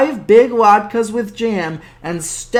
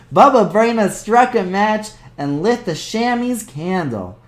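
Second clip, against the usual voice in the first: ordinary delivery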